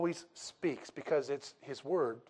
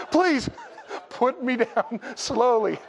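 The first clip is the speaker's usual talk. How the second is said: pleading dead man; 8 semitones higher, 5 dB louder, slower than usual. Pretend. Dead man speaking from coffin.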